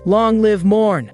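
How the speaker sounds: slow, high, joyful cheer; happy shout. Acted. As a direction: shout it out with delight. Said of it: "Winnie happily shouts".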